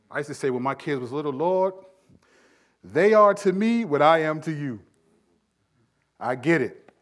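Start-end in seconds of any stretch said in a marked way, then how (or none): 1.3-4.8 loud, raised-pitch appeal to God; exclaimed prayer. Retold or imitated. Parent praying about little kids, loud and exclaiming. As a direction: call it out to the Lord.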